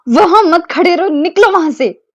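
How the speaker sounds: high, loud shooing; indignant demand; flustered order out. Acted. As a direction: get him out of the room now. She orders him out.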